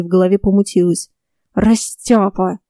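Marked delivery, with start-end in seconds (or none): 1.5-2.6 cursing. A narrator's reading. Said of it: "выругался Эдриан".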